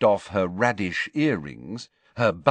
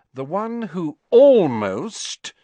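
"almost" drawn out slowly and loud; heavy emphasis on almost; 9 semitones higher, 7 dB louder, slower than usual. A narrator's reading. Hermione describing her date, great loud stress on "almost". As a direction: lean hard and long on "almost". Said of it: "put a great deal of emphasis".

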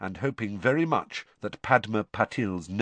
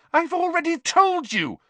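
very high, loud, angry retort; 6 dB louder than usual. Acted. Hermione refusing Ron once more, very angrily. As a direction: lose patience, pitch shooting up. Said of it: "Hermione said very angrily".

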